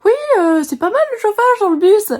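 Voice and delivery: high put-on voice of an old man chatting her up; old man's voice. Imitating the old man on the bus, high voice. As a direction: pitch up to mimic the old man. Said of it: "il m'aborde en disant".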